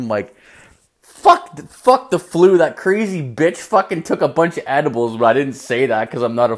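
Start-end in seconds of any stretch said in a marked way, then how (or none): 1.0-5.2 exasperated outburst. Retold or imitated. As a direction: act out an indignant inner rant. Unspoken outburst at the flu excuse.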